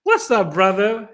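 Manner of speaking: high, hearty shout-out; excited greeting. Real, not acted. Host greeting a tribe member who just arrived, excited. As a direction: call out a big welcome.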